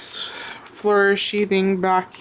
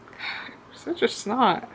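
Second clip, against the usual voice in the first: grumbling; 4 semitones higher, 8 dB quieter than usual. Real, not acted. Grumbling at pet.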